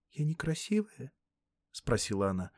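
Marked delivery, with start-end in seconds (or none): 0.1-1.1 small, high, soft voice; hurt young girl. Acted. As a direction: ask softly, wounded, in a girl's pitch. Hurt girl asks if she's ugly. (тихий обиженный голос девочки)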